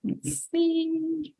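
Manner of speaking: very soft, high, drawn-out; absent-minded to oneself. Real, not acted. Host checking for viewer comments, softly to oneself. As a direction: trail softly while looking something up.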